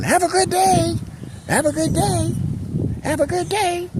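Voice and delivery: high, sing-song shouted greeting; cheerful yell. Retold or imitated. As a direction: call out brightly to passing cars.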